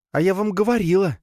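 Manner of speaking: high, weary I-told-you-so; sighing reproach. A narrator's reading. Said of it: "вздохнула Надя". (высокий женский упрёк со вздохом)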